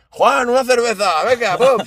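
high, slow, brash order to the barman; hammed-up rude customer, drawn out; mocking rude customer. Friend mocking a rude customer ordering beer, high and slow.

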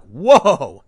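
loud startled "Whoa" as you back off. Retold or imitated. You back away from the pushy bar crowd, loud startled whoa. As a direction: recoil with a sudden loud whoa. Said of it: "you back away like".

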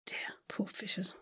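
hushed pitying aside; whispering. Real, not acted. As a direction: whisper it sadly.

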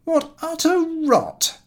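high, slow, scornful sneer; contemptuous. A narrator's reading. Lupin sneering at his father's dream, contemptuous. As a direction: scoff at the dream with disdain. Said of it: "in a most contemptuous manner".

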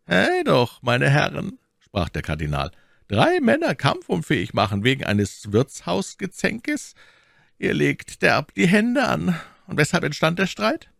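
high-pitched, lightly reproving man of power; reproving cardinal. A narrator's reading. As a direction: chide the men from above. Voices the cardinal reproving musketeers.

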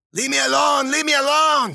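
high, loud wailing moan; moaned protest. A narrator's reading. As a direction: moan it out high, pleading to be left alone. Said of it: "she moaned".

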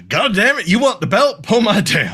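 high, loud mock scolding; cartoonish outburst at high pitch; hammed-up angry threat. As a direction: threaten the kid, overacting. Bighorn threatens the kid with belt.

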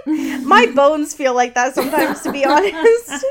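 high, loud words through laughter; laughing. Real, not acted. Joking with cohost about old bones, laughing hard. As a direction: laugh your way through the line.

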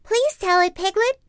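high, pleading little Roo; eager Roo. Acted. Roo urging Piglet to tell it, high pleading. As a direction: beg like an excited child. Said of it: "Roo said".

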